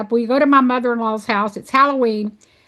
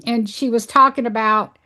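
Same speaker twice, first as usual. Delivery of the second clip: normal talking voice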